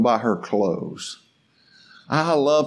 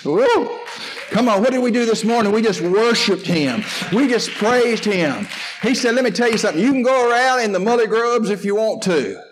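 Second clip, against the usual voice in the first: preaching at full force; 6 semitones higher than usual. Acted. Pastor exhorting his church, full preaching force. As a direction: fire up the congregation.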